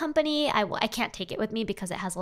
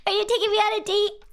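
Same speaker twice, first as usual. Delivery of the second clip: much higher and louder, giddy and fast; flirty squeal; 11 semitones higher, 4 dB louder than usual. Acted. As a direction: squeal like a flattered date.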